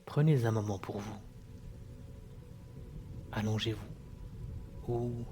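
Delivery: hushed and drifting, lull them down; sultry narrator. Acted. Leads a hypnosis meditation.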